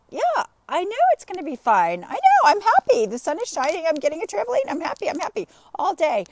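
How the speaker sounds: high, loud mock-frustrated outburst; hammed-up irritated cheerfulness; exasperated insistence. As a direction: protest that you're happy while sounding anything but. Mimics her own stressed, insistent reply.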